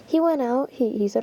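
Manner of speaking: ordinary talk, nothing marked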